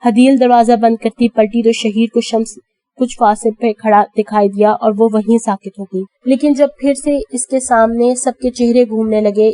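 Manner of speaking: usual speaking voice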